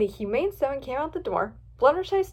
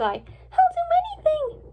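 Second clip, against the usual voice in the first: high, slow, louder begging; drawn-out, high-pitched desperation; crying plea; 5 dB louder, slower than usual. A narrator's reading. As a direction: offer anything to be freed. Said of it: "cried Fluttershy".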